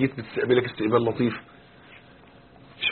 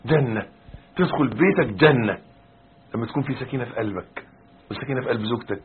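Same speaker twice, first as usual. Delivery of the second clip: normal talking voice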